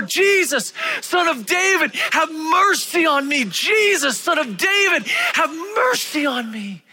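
very high, loud shout. Acted. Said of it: "he just cries out all the more".

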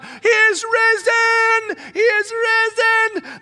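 high, loud ecstatic cry; joyful shout. Pretend. Women the preacher imagines running and shouting the news, in a high loud joyful cry. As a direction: shout it out in joy.

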